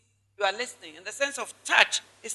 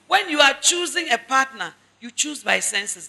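ordinary talk, nothing marked

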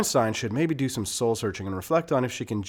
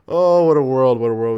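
exasperated sigh; 8 dB louder than usual. Real, not acted.

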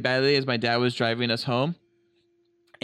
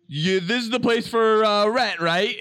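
fake casual bluff; 6 semitones higher, 4 dB louder, slower than usual. Bluffing to the landlady.

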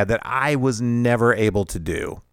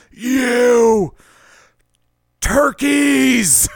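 high, loud furious shout out the car window; a dad yelling at snow-cone throwers; angry dad yell; 13 semitones higher, 6 dB louder, much slower than usual. Retold or imitated. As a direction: bellow it at the kids. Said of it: "he goes".

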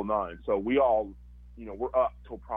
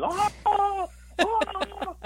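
high, loud celebratory screams; screams of joy; 4 dB louder, much slower than usual. Retold or imitated. Friends celebrating the draft pick, high joyful screaming. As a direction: whoop like the whole house erupting.

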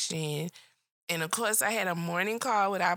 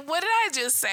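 high, louder, whiny fed-up "What did I just say?"; mock exasperated; 8 semitones higher, 3 dB louder than usual. Coworker mocking a friend's fed-up message to the boss, high and whiny. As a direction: whine it like she's completely fed up. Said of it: "That girl said".

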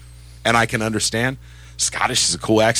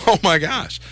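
mock amazement; 7 semitones higher, slower than usual. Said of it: "And I was like".

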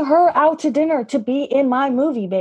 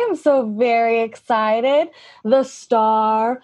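ordinary delivery

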